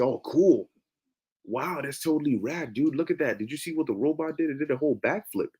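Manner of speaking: soft, fast valley dude; lightly voiced impressed surfer dude; trying too hard cool. Voicing an oblivious person.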